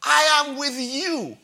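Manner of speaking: high, loud proclamation; booming preacher's declaration; proclaiming Jesus' words. Acted. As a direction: ring it out as Jesus' promise. Preacher voicing Jesus' promise.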